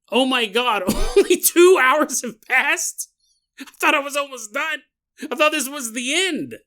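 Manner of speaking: shocked disbelief. Retold or imitated. Prisoner realizing only two hours have passed, horrified disbelief. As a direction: gasp it out as the awful truth hits.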